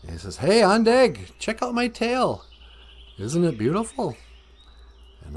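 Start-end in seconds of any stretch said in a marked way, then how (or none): 0.4-4.2 high-pitched, eager show-off. Acted. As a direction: call a friend over to admire you. Beaver shows off tail to crow.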